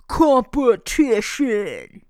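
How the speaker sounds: drawn-out, loud mock fanfare word; hammed-up, slow game-show style reveal; odd announcer noise. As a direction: stretch it out into a silly, distorted noise. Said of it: "what that noise was".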